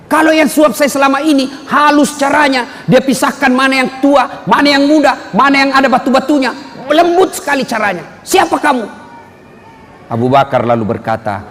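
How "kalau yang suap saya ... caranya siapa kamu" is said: higher voice, insistent questioning; blind man. Retold or imitated. Blind man telling Abu Bakar about his feeder, voice raised. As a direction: describe the gentle feeder, then demand again.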